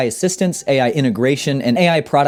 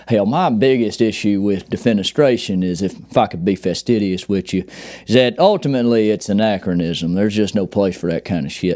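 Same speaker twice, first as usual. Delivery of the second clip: put-on Southern dad accent; 5 semitones lower than usual. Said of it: "He says things like".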